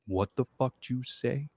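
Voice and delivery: a half-hearted Toby; Toby reacting to the word, lightly voiced; Toby impression. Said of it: "That's not Toby's voice".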